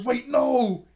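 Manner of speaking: high, loud mock panic; panicked villain. Villain being sealed in the book, loud panicked yelp. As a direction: yelp it as the villain gets trapped. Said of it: "He goes".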